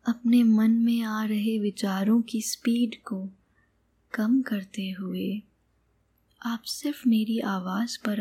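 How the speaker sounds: calm meditation guide, long pauses between phrases; guided meditation. Acted. Meditation guide leads listener on an imagined sky journey, calm and unhurried. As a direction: guide the listener gently, leaving space after each phrase.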